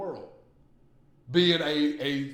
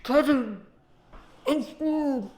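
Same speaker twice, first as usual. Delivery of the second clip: creepy ghost sister; 10 semitones higher, 6 dB louder, much slower than usual. Pretend. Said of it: "and she like".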